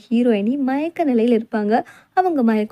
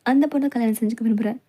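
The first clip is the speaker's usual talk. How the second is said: normal talking voice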